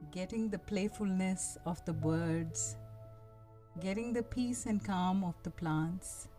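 calm guiding voice, unhurried; soft spa whisper. Acted. Leads a guided meditation.

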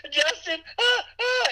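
mock high-pitched fangirl squeal; overjoyed crying fan. Retelling a fan's call from Justin, mock overjoyed squealing. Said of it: "always crying out of happiness".

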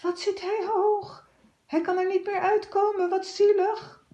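put-on high, trembling boy's voice; trembling little voice. A narrator's reading. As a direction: pity the cat tearfully. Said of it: "met een bevend stemmetje". (een hoog, bevend stemmetje)

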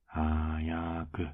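a teasing, lingering hurry-up; drawn-out coaxing. Acted. Boyfriend prodding shy partner to confess, drawn-out coaxing. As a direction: stretch each syllable out long.

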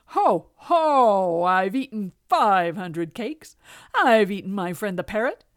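loud, booming cat brag; boastful cat. A narrator's reading. Cat boasts to the king, loud and swaggering. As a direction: pile up the boasts with swaggering force.

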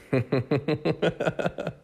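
high half-forced giggle; awkward half-fake laugh; slightly fake laugh. Real, not acted. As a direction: laugh a little on purpose. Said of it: "That laugh was a little bit fake".

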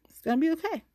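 high, sing-song reassurance; talking herself down in a lilting tone; mock reassuring. Shown as a demonstration. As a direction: soothe yourself in a lilting high voice.

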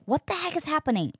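high, fast baffled cry; exasperated at herself in the stall; what is going on, high and quick; frustrated. Retold or imitated.

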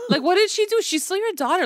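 what did she even do to you; incredulous. Real, not acted. Exasperated at the character.

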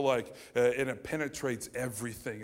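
ordinary talk

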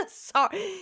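ordinary delivery

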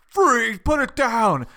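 loud, high cop commands; barking police commands. Retold or imitated. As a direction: bark it like a police officer. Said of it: "cop mode voice".

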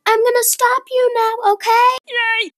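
put-on high-pitched, chirpy girl's voice; girl at the bottom. Girl telling the zipliner she will stop them, high put-on voice. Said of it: "this girl said".

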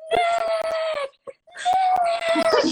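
high, drawn-out fangirl scream; screaming a name. Retold or imitated. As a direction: holler it long and high across a street. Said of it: "running across the street going".

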